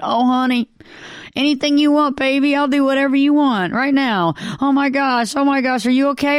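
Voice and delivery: high, sugary coddling voice; baby-talk a spouse like a toddler; doting codependent spouse. Pretend.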